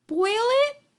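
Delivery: high, shocked question; aghast, voice leaping up; gasping. A narrator's reading. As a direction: blurt it in horrified disbelief. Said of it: "gasped Horton".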